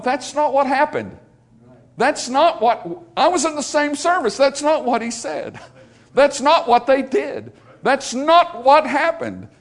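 pleading correction, slowing down; exasperated insisting. Retold or imitated. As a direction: hammer home that it never happened. Insisting on the truth to gossipers.

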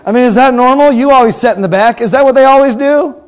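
loud, high teasing call to the back rows; mock shout. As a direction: tease the losers at the back, loud. Preacher mocks congregation, loud.

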